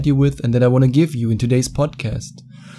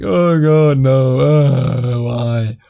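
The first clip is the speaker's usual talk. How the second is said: hammed-up heartbroken moan; mock anguish; much slower than usual.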